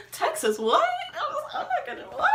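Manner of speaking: high, laughing, stunned sputter; freaking out. Retold or imitated. Caught out by mom about Texas, squeaky flustered shock. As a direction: re-enact panicked disbelief with a laugh. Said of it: "freaking out".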